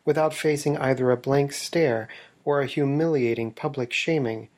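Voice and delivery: dry, earnest investigative narrator voice; deadpan documentary narration. Acted. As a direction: narrate it like a serious exposé, bone-dry.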